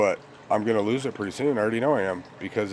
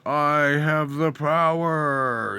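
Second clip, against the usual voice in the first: slow, drawn-out heroic cry; a hint of a cartoon hero raising his sword; lightly voiced cartoon hero, slow; He-Man; 5 semitones higher, 4 dB louder, much slower than usual. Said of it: "remember the cartoon, uh, He-Man".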